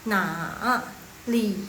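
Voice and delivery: "na, li" each falling then rising; full dip-and-rise on both "na" and "li"; full third tones. Shown as a demonstration. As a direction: say "na" and "li" apart, each dipping down and back up. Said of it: "Turun dulu, baru dia naik".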